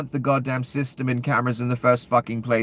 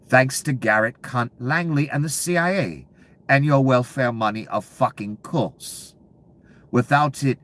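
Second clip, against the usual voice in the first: ordinary delivery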